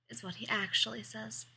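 ordinary delivery

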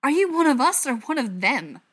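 loud, high angry demand; spat out. A narrator's reading. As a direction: demand it furiously. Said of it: "the butcher spat".

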